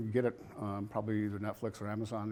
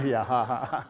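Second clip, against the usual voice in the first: laughing; 5 semitones higher, 7 dB louder than usual. Real, not acted. Laughing after a joke about voices.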